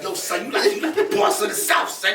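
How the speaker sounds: hyped New York "son" voice, cracking up; a New York friend calling him boss of the South, in a mock New York accent. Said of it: "he's like".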